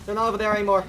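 usual speaking voice

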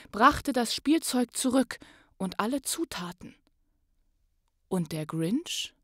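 lively rhyming read-aloud, rising to loud on the joyful parts; story narration. Acted. Podcast host reads the Grinch story to listeners, lively storytelling. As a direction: tell the Grinch tale with swelling excitement.

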